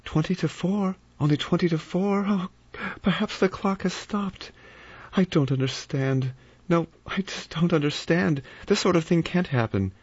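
high, strained, near-hysterical thoughts; frightened rising panic; panicked inner voice. A narrator's reading. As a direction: spiral toward breaking down.

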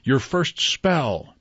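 slow, high, joyful cry; delighted. A narrator's reading. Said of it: "cried out in delight".

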